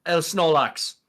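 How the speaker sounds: mock German accent; imitating a German passerby. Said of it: "someone in a German accent just go".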